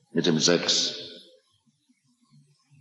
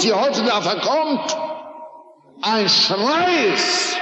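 high, intense pulpit preaching, pressing each phrase; fiery preaching; 10 semitones higher than usual. Acted. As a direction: hammer the listener's guilt from the pulpit. Preaching climax on youth and sex.

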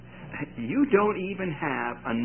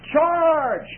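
high, loud battle cry; commander's yell; 14 semitones higher, 6 dB louder than usual. Retold or imitated. Commander ordering troops forward, shouted. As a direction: bark the order to charge. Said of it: "the commander yells".

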